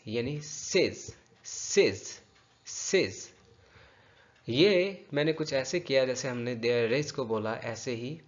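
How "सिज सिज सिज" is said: "सिज" as a separate syllable, s carried onto "is"; drawn-out "सिज" from "this is"; linked syllable drill. Shown as a demonstration. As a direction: repeat "सिज" slowly, one at a time. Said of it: "ये this is".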